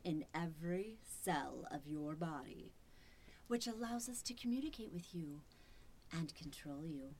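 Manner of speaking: soft sinister whisper; menacing whisper. Acted. Machine whispering threats to the captive man. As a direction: breathe the threat close to his ear.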